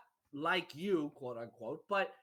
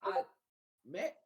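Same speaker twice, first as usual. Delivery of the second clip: ordinary talk, nothing marked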